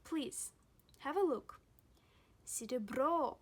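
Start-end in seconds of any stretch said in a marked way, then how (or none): 2.5-3.4 "серебро" with a louder, lengthened last O; emphatic sidiBRO for "серебро"; stress on final O. Shown as a demonstration. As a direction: punch "бро" in "серебро". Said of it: "The stress falls on O".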